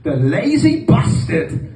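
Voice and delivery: high, slow, strained dad impression; mock the grumbling father; Pakistani dad voice. Comic imitating his dad's slow grumble.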